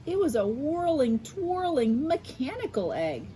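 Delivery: bright storybook narration; reading to children. A narrator's reading. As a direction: read it like bedtime for kids. Reads picture book aloud.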